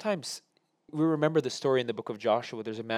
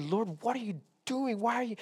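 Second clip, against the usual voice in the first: high, pleading complaint at God; angry cry to God; 10 semitones higher than usual. Retold or imitated. David complaining to the Lord in anger, high protest. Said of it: "his first response to the Lord is anger".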